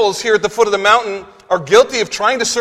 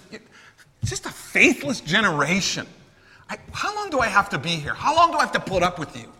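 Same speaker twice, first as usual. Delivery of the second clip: softer, weary exasperation; frustrated Jesus; 6 dB quieter than usual. Retold or imitated. Preacher voices Jesus rebuking the faithless generation, wearily exasperated. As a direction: sigh out your impatience with them. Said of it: "You can hear Jesus' tone".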